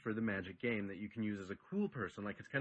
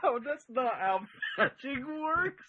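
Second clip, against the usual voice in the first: laughing, much higher pitch; 12 semitones higher, 4 dB louder than usual. Real, not acted. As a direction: correct him while laughing. Laughing correction.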